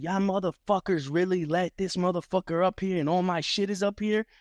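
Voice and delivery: imitating angry Chucky. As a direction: ham up the rival's indignation.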